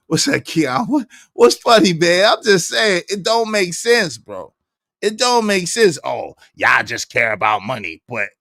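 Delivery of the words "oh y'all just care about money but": much deeper mock voice, quickening at the end; mocking impression. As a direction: drone it low and sneering. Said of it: "it would be a good troll".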